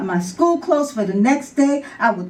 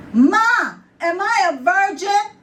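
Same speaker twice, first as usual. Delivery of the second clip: put-on high-pitched child's call to Ma; loud yell; 8 semitones higher than usual. Girl calling to Ma in the kitchen, loud yell. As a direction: yell it out to the next room, high. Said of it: "I yelled loudly out".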